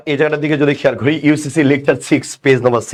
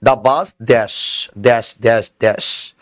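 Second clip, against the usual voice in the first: slow drawn-out reading; 3 semitones lower, much slower than usual. Shown as a demonstration. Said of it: "right?".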